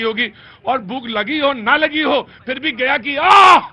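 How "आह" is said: loud, high "aah"; exclaimed "aah". Pretend. Host plugging Gaya's food to viewers, loud "aah". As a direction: let out a big sudden "aah". Host's relish cry in sponsor plug.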